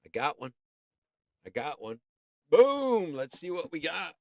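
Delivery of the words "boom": high exclamation, falling away; playful boom. Pretend. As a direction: pop it out high like a blast. Calls out after picking.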